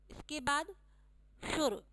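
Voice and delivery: ordinary delivery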